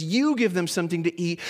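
"You" stressed at the front of the line. Retold or imitated. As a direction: lean hard on "You", then run on. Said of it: "there's this emphatic".

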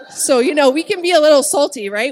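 loud laughter through the words; laughing. Real, not acted. Wife teasing her husband, laughing loudly. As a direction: laugh out the line.

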